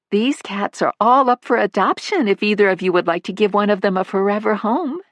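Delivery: high, light motherly voice; a fond older mother chatting about her son; elderly mother. Acted. Missus Manley offers cats.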